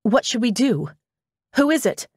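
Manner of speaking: high, eager rushed questions; can't wait to hear; excited. Acted. Eager rush.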